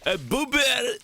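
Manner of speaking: high put-on Polish voice crying A boober; mock Polish. Streamer answering his own joke, mock Polish squeal.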